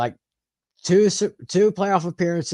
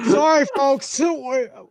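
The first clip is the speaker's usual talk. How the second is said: laughing; 11 semitones higher, slower than usual. Real, not acted. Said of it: "I've not laughed so hard".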